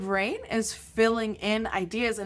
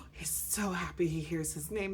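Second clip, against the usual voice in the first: mock gasp, then softer, thrilled hush; hushed delight; 3 semitones lower, 8 dB quieter than usual. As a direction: play a softly awed, happy lover. Voicing Romeo's delight at his name.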